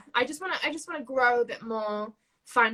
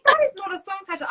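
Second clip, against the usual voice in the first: mum's brisk dismissal; 9 semitones higher, 8 dB louder, faster than usual. Retold or imitated. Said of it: "She's like".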